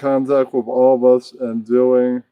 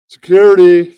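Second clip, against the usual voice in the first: loud call, higher; calling security; 5 semitones higher, 11 dB louder than usual. Acted. Host shouts for security to remove the heckler. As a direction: yell it across the room for help.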